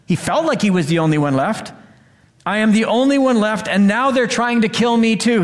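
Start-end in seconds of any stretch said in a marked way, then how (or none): 2.4-5.4 desperate prophet. Acted. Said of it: "he says".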